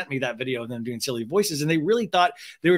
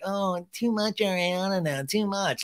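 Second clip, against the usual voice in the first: lightly voiced whiner, a bit higher; a hint of a put-on complainer; mocking naysayer; 5 semitones higher than usual. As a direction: mildly mimic a complaining naysayer. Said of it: "naysayers that are like".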